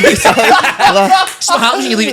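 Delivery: loud, slow words pushed out through laughter; laughing. Real, not acted. Teasing his mate for leaving at ten, through loud laughter.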